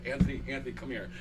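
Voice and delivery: soft, low, frail call; dying mother. Retold or imitated. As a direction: call weakly from a deathbed. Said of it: "my mother went".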